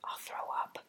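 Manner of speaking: hushed whisper of a worry. Retold or imitated. Whispering her worry about airsickness to viewers. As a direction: breathe it out like a nervous secret. Worried aside about getting sick.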